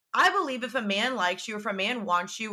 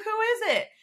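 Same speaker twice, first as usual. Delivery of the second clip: much higher, desperate to know; shrill urgent who is it; frantic question; 13 semitones higher, 3 dB louder than usual. Retold or imitated. Retelling pressing her friend for the name, high frantic.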